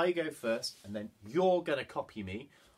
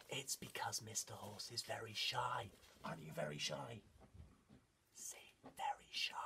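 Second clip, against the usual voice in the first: soft near-whisper about someone shy; hushed confiding; 5 semitones lower, 15 dB quieter than usual. Pretend. Leader confides to children about shy horse, hushed. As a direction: lower the voice so as not to startle.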